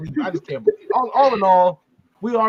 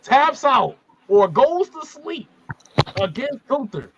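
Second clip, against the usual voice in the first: mock solemn vow; 6 semitones higher, 3 dB louder than usual. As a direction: swear it like a pledge to the kid. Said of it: "and I'm saying this to the kid".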